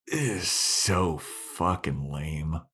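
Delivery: slow, drawn-out disdain; heavy, stretched-out retold reaction; unimpressed. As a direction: drag each word out in disapproval. Speaker's disgusted reaction, drawn out.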